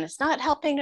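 put-on high, sulky kid's protest; high, grudging child's voice; mimicking son protesting. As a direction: pitch up into the child's complaint.

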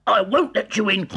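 very high, loud piggy squeal; squeaky little pig. A narrator's reading. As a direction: squeal it out defiantly. Pig refuses the wolf.

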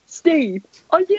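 demonstrating a mocking "are you serious"; mock correction. Shown as a demonstration. Rival correcting champ's delivery of the line, mock correction. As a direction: show him how to say it, exaggerated. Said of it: "you didn't say it right".

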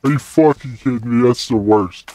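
ordinary delivery

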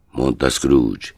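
ordinary talk, nothing marked

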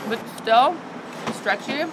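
chewing while talking; talking around hot food; mouth full. Real, not acted. Seen 3: Lips pursed, cheeks full, chewing after bite, face clearly visible.

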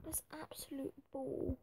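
whispered "That's absolute bull." with a long falling "bull"; disgusted whisper. Real, not acted. Gamer fuming quietly at a rejected bid, whispered. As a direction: hiss it under the breath in disbelief. Frustrated over failed transfer bid.

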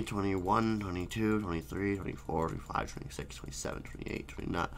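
counting fading out. Pretend. Counting off the seconds, fading to a whisper. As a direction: let the count fade away to nothing.

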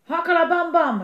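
full-volume high shout; huge, high war cry; battle cry. A narrator's reading. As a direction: bellow a warning before attacking. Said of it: "riep Melle ook".

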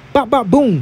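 high punchy bop bop boom, mimicking blows; playful punch noises mimicking a combo; punch sound effects. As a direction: spit out the punch noises like a fight commentator. Miming punches landing.

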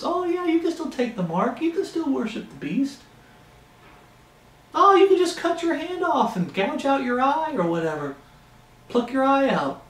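high, soft, sneering mock reassurance; mocking false prophet. Preacher mocking a false prophet's soft lies, high and soft. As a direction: coo the lie up high like a smooth deceiver. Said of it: "deceived by false prophets".

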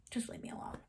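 breathy whisper, almost no voice; whispered brush-off. Retold or imitated. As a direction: hush it out, shutting her down. Snapping at a castmate who checks.

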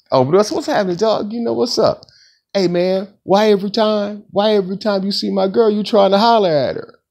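lightly voiced friend accusing him of chasing his girl; imitating homeboy. Homeboy confronting him at door.

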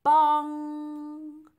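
loud, high bong with a long ringing hum; sung-out bell strike, held on the ng; ringing bell imitation. A narrator's reading. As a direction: strike it hard and let it ring.

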